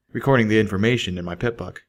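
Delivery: ordinary delivery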